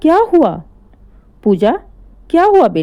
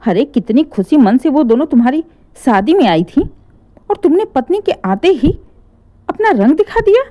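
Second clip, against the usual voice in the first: angry; 4 semitones higher than usual. Acted. Mother scolding son.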